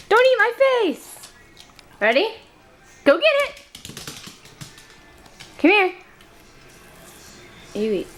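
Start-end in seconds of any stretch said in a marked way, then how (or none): 0.1-1.3 high, slow squeaky plea; high pleading squeak at the dog; high squeal. Real, not acted. 3.0-5.9 high excited dog-calling voice. Real, not acted.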